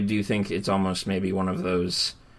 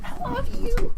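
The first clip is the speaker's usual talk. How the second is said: much higher, gushing love declaration; mock swoon; 3 dB louder, slower than usual. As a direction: coo it in falsetto. Joking affection between friends.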